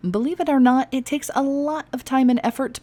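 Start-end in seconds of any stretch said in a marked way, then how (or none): none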